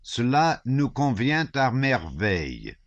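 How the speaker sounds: mocking wit. A narrator's reading. Shinshin's mocking French aside.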